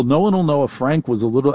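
ordinary talk, nothing marked

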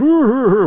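a sudden, high, loud laugh; loud burst of laughter. Real, not acted. Radio host cracking up at his own office gossip joke, loud laugh.

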